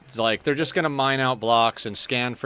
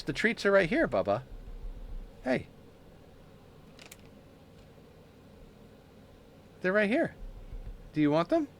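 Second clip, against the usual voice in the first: much higher, sing-song coaxing of a kitty; high pet talk; 7 semitones higher than usual. Acted. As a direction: lure the cat to the treats in your hand. Coaxing cat with treats.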